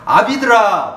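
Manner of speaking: solemn loud scripture read. Acted. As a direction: proclaim the verses to the congregation. Reads scripture aloud.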